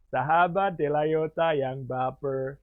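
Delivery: Mario Teguh. Shown as a demonstration. Teacher demonstrates memorable vocal tone, imitating a well-known speaker. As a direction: stretch each word in a signature speaker's tone. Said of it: "kamu akan ingat Pak Mariono".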